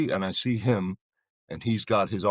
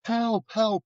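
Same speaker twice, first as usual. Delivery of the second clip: much higher mock cry for help; imitating a woman's screams; 13 semitones higher than usual. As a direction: pitch it up like a distant scream. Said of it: "I hear a woman screaming".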